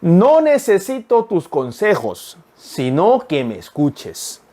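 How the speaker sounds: very slow, careful Spanish sentence; slow teacher read-aloud; slow model reading. Shown as a demonstration. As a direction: read it slowly so students can follow. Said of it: "읽어보겠습니다".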